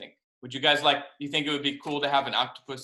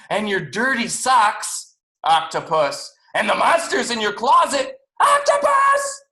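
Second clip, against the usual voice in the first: loud goodnights building to a high yell; loud, drawn-out mock outrage, climbing; exasperated shouting; 8 semitones higher, 8 dB louder, slower than usual. A narrator's reading. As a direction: get louder and higher to a final shout. Boy mockingly shouts goodnights at octopus.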